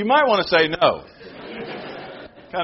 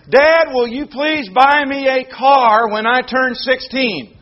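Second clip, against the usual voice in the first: put-on pleading child; 7 semitones higher, 4 dB louder, slower than usual. Child begging parent in sermon.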